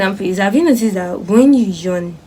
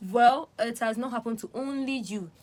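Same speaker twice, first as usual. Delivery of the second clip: ordinary delivery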